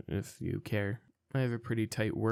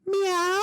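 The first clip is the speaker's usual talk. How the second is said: high, loud, drawn-out meow; a grown man's sudden cat call; imitated cat meow; 7 dB louder than usual. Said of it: "he looks at me and he just goes".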